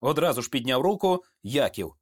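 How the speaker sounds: usual speaking voice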